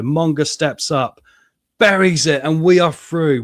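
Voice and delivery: rising to a loud triumphant shout; excited commentary. Real, not acted. Manager calling his side's winning penalty, excited and loud. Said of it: "Why am I getting excited?".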